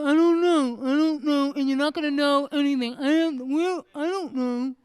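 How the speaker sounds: buck-toothed, clueless husband impression; mocking husband voice. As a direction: talk like a dopey married man. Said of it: "and you're gonna talk like that".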